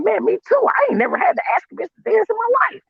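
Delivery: high, eager me too; high-pitched eager agreement; excited agreement. Retold or imitated. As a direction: jump in, thrilled to agree. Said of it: "I'm like".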